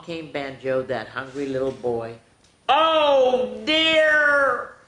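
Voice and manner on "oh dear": high, loud mock alarm; dismayed oh dear. A narrator's reading. Narrator reacts to sausage's fate with loud alarm. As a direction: gasp it out in storybook horror.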